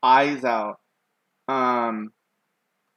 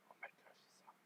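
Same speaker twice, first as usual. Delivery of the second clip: hushed, unvoiced-sounding whisper of realization; panicked whisper; 36 dB quieter, much faster than usual. Boy realizing his crush saw him, panicked whisper. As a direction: whisper it to yourself in mock dread.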